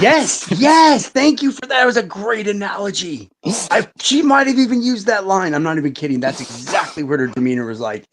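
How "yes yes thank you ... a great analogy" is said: excited agreement. Real, not acted. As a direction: cheer him on for nailing it. Cheering a friend's great analogy.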